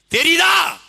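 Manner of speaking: shouted repeat. Real, not acted. Speaker demands an answer from the rally crowd, shouting. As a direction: bellow it at the audience.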